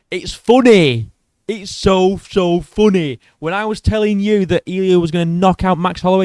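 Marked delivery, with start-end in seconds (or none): 0.1-3.2 loud, high, stretched-out gloating; real gloating. Real, not acted. YouTuber taunting his buddy about Holloway's loss, emphatic gloating. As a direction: rub it in, slow and pitched up.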